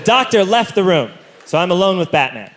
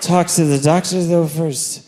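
timid nervous request; 4 semitones lower, 4 dB quieter, slower than usual. Retold or imitated. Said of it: "And I said".

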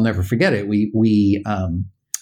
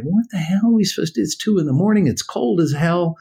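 stranded exasperation; 6 semitones higher, faster than usual. Retold or imitated. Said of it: "we're all kinda standing there like".

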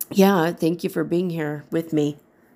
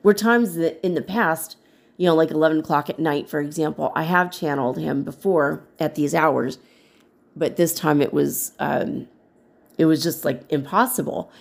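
ordinary talk, nothing marked

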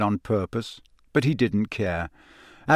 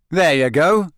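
angry taunt; 5 semitones higher, 6 dB louder than usual. Acted. Harry furious at Ron.

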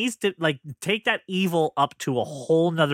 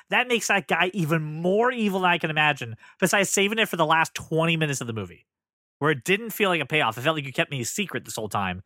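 ordinary delivery

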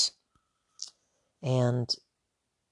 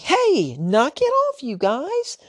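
much higher, louder whining snap; whiny snap; 13 semitones higher, 3 dB louder, much slower than usual. Retold or imitated. Dad whining at his sons to knock it off, high and whiny. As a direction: whine it out like an annoyed dad. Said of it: "So I kinda snapped, I kinda whined a little".

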